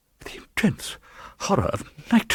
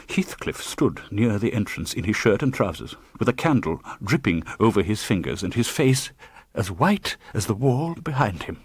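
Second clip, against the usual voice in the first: ordinary delivery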